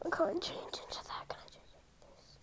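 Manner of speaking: soft hushed whisper. Real, not acted. Hiding, whispers.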